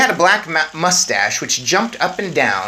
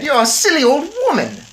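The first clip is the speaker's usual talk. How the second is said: haughty Mrs Jenkins; 14 semitones higher, 7 dB louder than usual. Acted. Snobbish mother dismisses the old woman, high and loud. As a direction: look down your nose at her. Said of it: "Miss Jenkins said".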